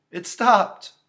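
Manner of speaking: put-on distressed little-girl voice; alarmed child. A narrator's reading. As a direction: tell the sitter in dismay. Child reports dead line.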